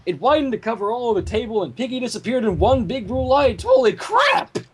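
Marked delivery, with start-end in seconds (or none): 3.6-4.6 much higher exclamation; startled outburst. Real, not acted. Reader reacting to Pinky vanishing in blue light, shocked. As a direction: blurt it in shock.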